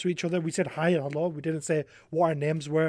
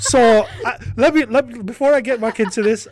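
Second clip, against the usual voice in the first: laughing; 7 semitones higher, 10 dB louder than usual. Real, not acted. Laughing before telling story.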